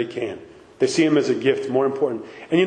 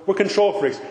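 normal talking voice

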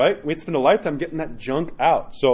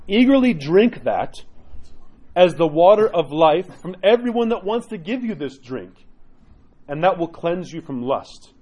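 solemn quotation reading; slower than usual. Acted. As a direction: let each word of the quote land. Reads a saint's quote aloud.

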